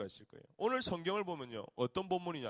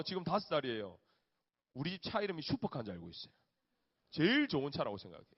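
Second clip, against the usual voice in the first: ordinary talk, nothing marked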